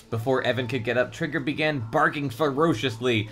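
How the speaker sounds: ordinary delivery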